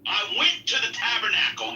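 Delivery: ordinary talk, nothing marked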